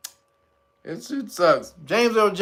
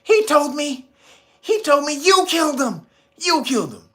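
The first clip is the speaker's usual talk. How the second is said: put-on higher, pleading young hero; Luke Skywalker; 6 semitones higher than usual. Luke accusing Vader of killing his father, high and upset.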